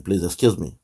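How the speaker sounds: normal talking voice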